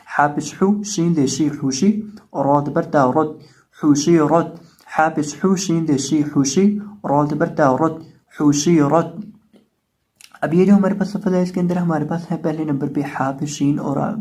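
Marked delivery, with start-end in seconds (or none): 0.1-9.2 spelling recitation drill. Shown as a demonstration. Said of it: "spelling drill".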